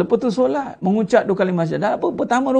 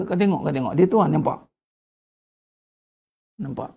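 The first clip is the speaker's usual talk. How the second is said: low, hushed tempting murmur; sly devil whispering to husband; 6 semitones lower, 4 dB quieter than usual. Retold or imitated. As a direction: whisper it low like Satan egging him on.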